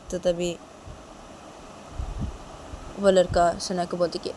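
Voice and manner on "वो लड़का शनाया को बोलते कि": ordinary delivery, nothing marked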